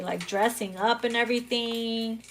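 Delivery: normal talking voice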